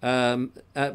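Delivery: ordinary talk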